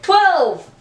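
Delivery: high, falling exclamation; shout-free burst of excitement, pitch jumping up; enthusiastic. Shown as a demonstration. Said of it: "you just have to say it with enthusiasm".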